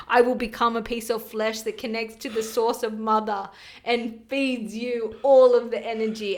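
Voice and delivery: loud, solemn warrior vow; Spartan placenta. Acted. Placenta vowing to the baby, loud Spartan warrior voice. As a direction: declare it like a heroic Spartan oath. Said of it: "is the placenta from Sparta or somewhere".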